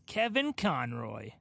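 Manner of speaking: ordinary talk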